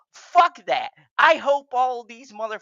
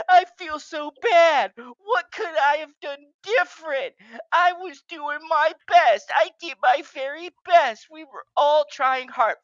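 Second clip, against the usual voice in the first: whiny mock defendant; 6 semitones higher than usual. Ranting host mocking the defendants' excuses in a whiny voice. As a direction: mimic a self-pitying excuse-maker.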